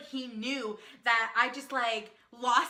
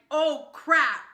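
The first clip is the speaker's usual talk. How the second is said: mock-dismayed outburst, louder; alarmed realization; 3 semitones higher, 7 dB louder than usual. Man realizing the fan knows him, mock alarm. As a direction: blurt it as if suddenly recognized.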